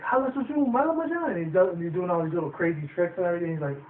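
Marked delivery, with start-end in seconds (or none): 0.0-1.3 much higher singsong incantation; hammed-up magician casting a spell; mock magic words. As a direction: magician doing tricks, high mock incantation.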